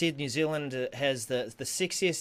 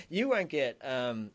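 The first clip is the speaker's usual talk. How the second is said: ordinary talk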